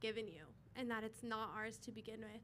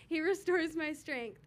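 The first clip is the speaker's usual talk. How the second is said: getting emotional; 4 semitones higher, 9 dB louder than usual. Real, not acted. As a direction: push through the verse as tears rise. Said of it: "I don't know why I'm getting emotional".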